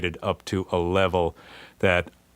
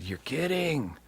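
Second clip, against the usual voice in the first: hushed, softer disbelief; stunned disbelief; 7 semitones higher, 8 dB quieter, slower than usual. Retold or imitated. Keyboardist hearing about free gear, hushed and softer. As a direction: breathe it out in disbelief. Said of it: "I said".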